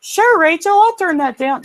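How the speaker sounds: very high echoing reply; husband answers Rachel in her high voice; mimicking Rachel back. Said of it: "I'm like".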